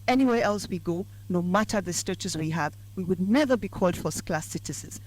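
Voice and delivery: ordinary delivery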